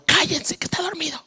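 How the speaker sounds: urgent hushed whisper; imitating a mother's whispered scolding; mother's hushing whisper. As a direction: shush the kids without waking him. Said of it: "y mi mamá".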